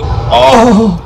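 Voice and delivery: loud high exclamation; excited shout. Real, not acted. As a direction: yell at the big hit. Big hit in fight.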